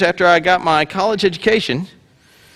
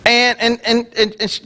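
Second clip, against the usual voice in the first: ordinary talk, nothing marked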